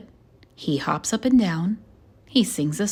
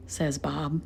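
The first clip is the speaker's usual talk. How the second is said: ordinary delivery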